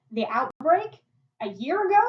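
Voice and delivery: loud, high rising disbelief; incredulous question. Acted. Boy realises what she means.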